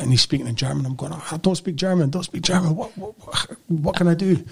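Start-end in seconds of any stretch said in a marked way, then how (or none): 1.1-4.4 mock-panicked, repeating yourself in a high voice; mild retold panic. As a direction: act out a helpless, stammering protest. Retold panic at German-speaking policeman.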